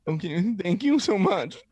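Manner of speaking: high, drawn-out mock sobbing gratitude; tearful thanks. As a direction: blubber your thanks slowly, playing it up. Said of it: "Just stop fucking crying".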